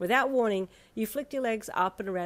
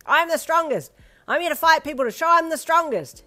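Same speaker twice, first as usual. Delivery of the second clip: loud proud boast; 9 semitones higher, 12 dB louder than usual. A narrator's reading. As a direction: brag about being strongest. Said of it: "he said proudly".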